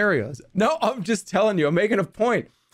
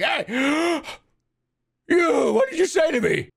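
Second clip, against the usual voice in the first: mock yell; 7 semitones higher than usual. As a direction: act out yelling back. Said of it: "I respond to your yelling and it's like".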